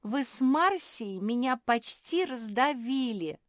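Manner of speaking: high, loud, slow complaint; aggrieved grumble. A narrator's reading. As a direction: protest at being squashed, drawing it out. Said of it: "пробормотала Наретта".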